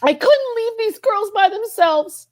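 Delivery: high, tearful voice, choked with feeling; real welling-up, pitch climbing far up; really emotional. Real, not acted. Grieving for friends left in the industry, overcome with emotion. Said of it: "I don't know why I'm getting so emotional".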